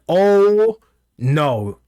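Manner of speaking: loud, high, drawn-out oh, then dropping on no; mock-dramatic "oh no"; retold dismay. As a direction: re-enact your dismayed reaction, stretching the oh. Retells own shocked reaction to scene.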